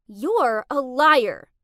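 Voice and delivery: loud, drawn-out accusing yell; outraged shout; angry accusation. Acted. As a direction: hurl the accusation slowly and hard. Confronting the friend who betrayed her.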